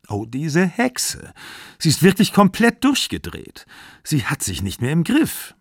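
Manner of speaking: high-pitched, agitated indignation; acted worked-up complaint; exasperated outburst. Daughter's outburst about jealous ex-lover.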